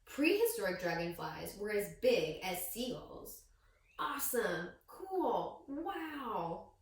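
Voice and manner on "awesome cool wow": put-on a touch of higher impressed kids; mock amazed classmates; lightly voiced kids' awe. Classmates reacting to the fact, mildly higher.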